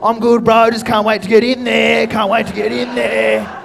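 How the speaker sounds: put-on slurred, cheery drunk covering up; drunk bluffing. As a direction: act sober while plainly drunk. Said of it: "They'll be like".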